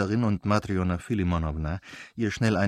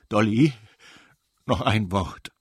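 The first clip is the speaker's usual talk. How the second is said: pleading call; 4 dB louder than usual. Acted. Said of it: "sprach er und folgte ihr".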